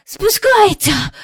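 high, loud, slow panting cry; out of breath. A narrator's reading. Michael straining at the kite string, out of breath. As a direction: puff it out between breaths. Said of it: "засапано сказав Майкл".

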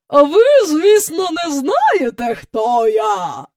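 high, loud opening sinking at the end; slow, imperious drawn-out question; booming woman. A narrator's reading. Miss Andrew asking if children know her, booming. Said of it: "прогула вона".

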